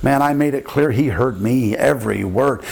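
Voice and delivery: ordinary delivery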